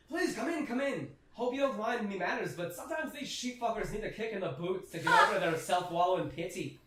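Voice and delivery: put-on high, lively accented innkeeper; welcoming dwarven barkeep. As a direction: beckon guests in, apologetic about the staff.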